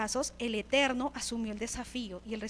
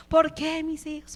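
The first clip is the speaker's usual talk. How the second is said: anguished outcry; 4 semitones higher, 6 dB louder, slower than usual. Acted. Believer crying out to God.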